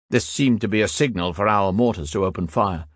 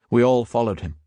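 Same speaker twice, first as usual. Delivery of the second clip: plain reading voice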